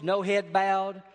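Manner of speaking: ordinary delivery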